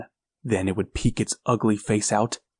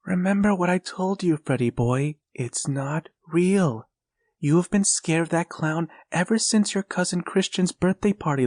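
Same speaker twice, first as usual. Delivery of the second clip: comforting mother; 7 semitones higher than usual. A narrator's reading. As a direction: soothe a scared boy at bedtime.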